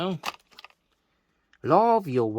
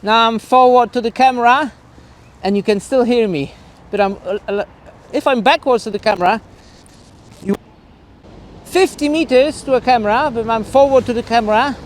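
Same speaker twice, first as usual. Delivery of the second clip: calling out from afar; 7 semitones higher, 5 dB louder than usual. Real, not acted. Shouting to distant camera outdoors.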